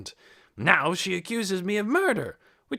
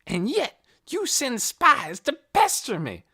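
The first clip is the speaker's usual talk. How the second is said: loud, high indignant ranting; railing; 13 semitones higher, 6 dB louder than usual. A narrator's reading. Hoyt railing at Missus Beeson before the officers, indignant. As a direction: rant in rising indignation. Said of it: "Hoyt railed on".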